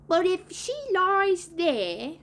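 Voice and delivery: put-on high, slow worried boy; put-on boy voice, drawn out; Toodles. A narrator's reading. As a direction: stretch it out high, then let it fall.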